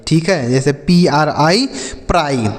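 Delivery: ordinary delivery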